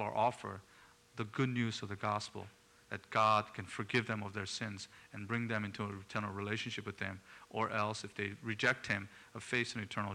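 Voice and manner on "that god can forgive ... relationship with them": normal talking voice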